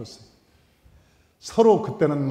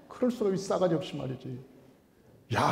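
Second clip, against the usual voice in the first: ordinary delivery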